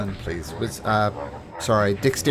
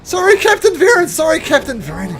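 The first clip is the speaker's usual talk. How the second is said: much higher, loud frantic apology; panicked apologizing soldier; 11 dB louder than usual. Acted. Soldier to his captain after hitting him by mistake, frantic apology. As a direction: cry out a horrified, high-pitched apology. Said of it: "And the guy goes".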